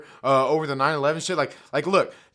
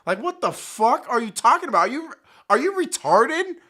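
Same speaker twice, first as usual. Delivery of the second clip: higher, louder disbelieving rant; can't believe what he's hearing; incredulous outburst; 7 semitones higher, 4 dB louder than usual. Real, not acted. Incredulous rant at TikTok users.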